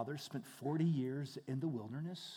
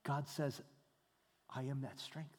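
much softer, intimate; soft hush; 3 semitones lower, 10 dB quieter than usual. Acted. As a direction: say it gently under the breath. Whispered answer from God.

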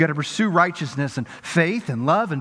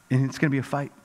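normal talking voice

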